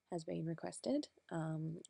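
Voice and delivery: ordinary talk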